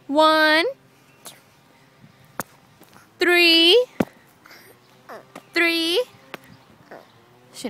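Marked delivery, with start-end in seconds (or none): 0.1-6.0 loud coaxing. Shown as a demonstration. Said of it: "counting with toddler".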